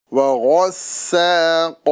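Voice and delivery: وغسا with a heavy غ and thin س; tajweed contrast; thin seen, heavy ghain. Shown as a demonstration. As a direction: make the غ full and the س light. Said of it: "غین اور قاف کو پر".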